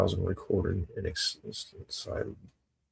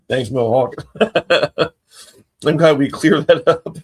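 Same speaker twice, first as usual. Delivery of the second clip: loud, high, bursting into laughs; laughing; 5 semitones higher, 10 dB louder than usual. Real, not acted. Thanking Mohawk for clearing it up, laughing. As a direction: barely get it out through laughter. Cracking up with the chat.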